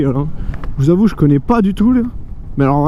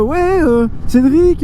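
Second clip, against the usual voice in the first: mock commenter voice; 8 semitones higher, much slower than usual. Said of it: "des commentaires qui disaient".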